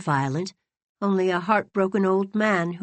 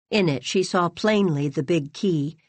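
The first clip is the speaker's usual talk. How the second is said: ordinary delivery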